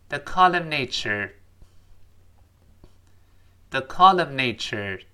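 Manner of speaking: slow model phrase. Shown as a demonstration. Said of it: "我们先读一下这个短语".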